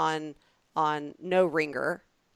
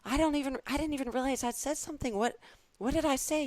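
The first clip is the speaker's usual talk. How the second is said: higher, startled disbelief; surprised; 8 semitones higher than usual. Retold or imitated. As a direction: genuinely caught off guard. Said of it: "And I said".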